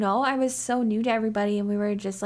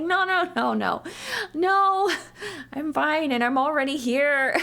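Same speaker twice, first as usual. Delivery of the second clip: insistent refusal; 6 semitones higher than usual. Retold or imitated. Refusing boss's offer to go home.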